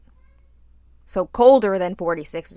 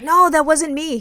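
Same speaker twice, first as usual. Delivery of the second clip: defensive denial; 8 semitones higher, 6 dB louder than usual. Retold or imitated. Friend denies retold.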